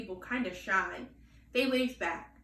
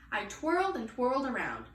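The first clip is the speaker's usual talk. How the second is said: ordinary delivery, nothing marked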